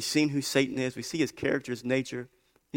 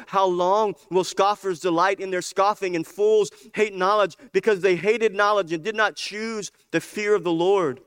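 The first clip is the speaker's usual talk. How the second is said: raised, driving preacher cadence; impassioned scripture reading; 7 semitones higher, 6 dB louder than usual. Real, not acted. Preacher reading Wisdom's rebuke to the scoffers, impassioned. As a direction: press through the verses urgently.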